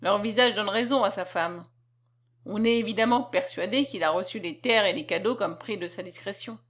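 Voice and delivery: ordinary delivery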